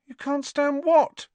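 high-pitched alarmed question. A narrator's reading. Harry questions his pale, sick-looking friend, alarmed. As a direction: ask it high, with growing alarm. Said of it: "starting to feel definitely alarmed".

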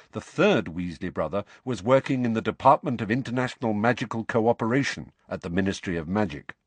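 ordinary delivery, nothing marked

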